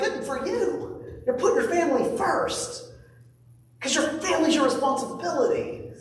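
a lightly voiced cheering friend; supportive friend. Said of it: "people be like".

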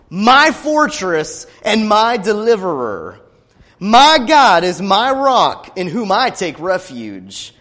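loud, slow proclaiming of scripture; full-force scripture reading; preaching the psalm. Acted. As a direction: declare each line like a battle hymn.

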